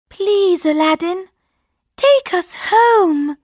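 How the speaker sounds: tearful plea; pleading princess. A narrator's reading. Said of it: "said the princess".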